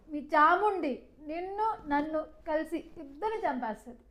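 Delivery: usual speaking voice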